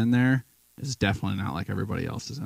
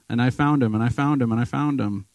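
FOUND hit harder each time, louder at first; emphatic repetition; 3 semitones higher, 4 dB louder than usual. Real, not acted. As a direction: press the word found, repeating it. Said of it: "repeats for emphasis".

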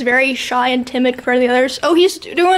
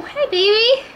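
puppy greeting; 9 semitones higher, slower than usual. Real, not acted.

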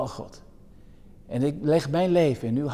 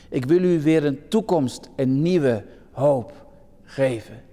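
slow, solemn Bible reading; scripture reading. Acted. Preacher reads Jeremia to the congregation, slow and solemn. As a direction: read the promise slowly, weighing each line.

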